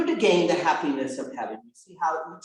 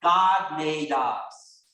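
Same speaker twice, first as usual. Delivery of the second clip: slow recited class answer; rote answer; much slower than usual. Shown as a demonstration. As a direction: chant it slowly like a drilled pupil. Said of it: "you're all supposed to answer".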